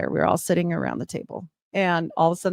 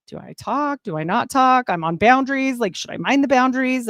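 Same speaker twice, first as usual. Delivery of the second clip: higher, frantic inner questions; a mock-frantic voice, pitched up, tumbling over itself; retold panic; 6 semitones higher than usual. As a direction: replay the flustered panic of the moment. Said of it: "I'm freaking out".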